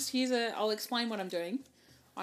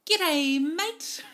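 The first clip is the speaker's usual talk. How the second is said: loud Kiwi greeting, pitched up; cheery New Zealand G'day; Kiwi accent; 6 semitones higher, 7 dB louder than usual. Real, not acted. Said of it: "why did you do that with a Kiwi accent".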